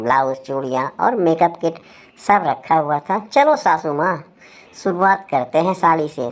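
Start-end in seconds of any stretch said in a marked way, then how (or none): none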